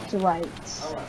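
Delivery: ordinary delivery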